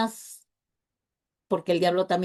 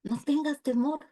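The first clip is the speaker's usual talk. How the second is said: soft, higher, reassuring voice of God; the Lord comforting; 4 semitones higher, 6 dB quieter than usual. Acted. Preacher voicing the Lord comforting a discouraged believer, soft and higher. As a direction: hush and lift it, gently reassuring.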